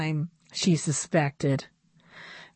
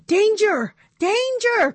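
much higher, louder warning cry rising on the second; alarmed shout; 5 dB louder than usual. A narrator's reading. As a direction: sound the alarm to the other dolls. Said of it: "cried Annabelle".

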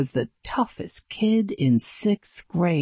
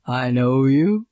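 lightly voiced tough kid, lower; low rumble; 4 semitones lower, 3 dB louder than usual. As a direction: rumble it like a big bully. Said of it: "he rumbled".

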